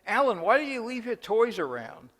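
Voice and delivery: a mother scolding her son loudly; mother yelling. Retold or imitated. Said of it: "starts yelling at him".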